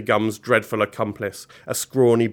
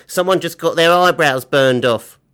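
loud, higher-pitched rough character voice; gloating accomplice; 5 semitones higher, 7 dB louder than usual. Acted. Billy loves seeing people hurt, loud gloating. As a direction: crow over someone's misfortune.

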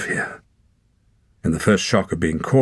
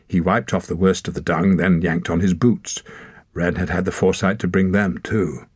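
usual speaking voice

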